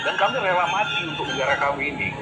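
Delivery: normal talking voice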